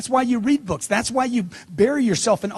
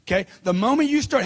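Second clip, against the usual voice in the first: ordinary talk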